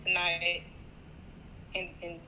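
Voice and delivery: breaking up on a bad line; words dropping out, buffering stutter; choppy connection. Real, not acted. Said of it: "you got a choppy connection".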